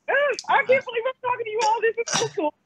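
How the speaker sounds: high, thrilled gush; starstruck excitement. Real, not acted. Caller thrilled to be talking to the channel hosts, starstruck.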